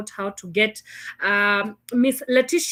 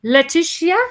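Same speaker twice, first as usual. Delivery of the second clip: higher, louder questioning call; 7 semitones higher, 6 dB louder than usual. Real, not acted. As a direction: call out the missing guest's name, voice rising. Checking if lost guest is on line.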